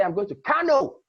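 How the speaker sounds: Kano punched high and loud; stress on Kano. Shown as a demonstration. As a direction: hit Kano, not Abuja. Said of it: "Kano already tells you that".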